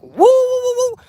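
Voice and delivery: high loud howling like a dog; dog howl imitation. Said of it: "She either barks like".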